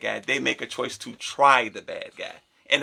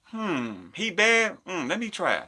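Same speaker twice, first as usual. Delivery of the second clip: mock girl's voice; 5 semitones higher, 3 dB quieter, much slower than usual. Girl voiced tempted by bad guy.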